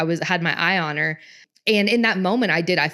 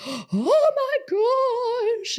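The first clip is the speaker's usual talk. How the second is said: high, shrill-free panicked cry of oh my gosh; panicked gasp; slower than usual. Retold or imitated. Mom panicking as her daughter falls, a sudden high alarmed cry. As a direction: gasp and panic over the fallen child. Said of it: "freaking out".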